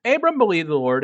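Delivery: ordinary delivery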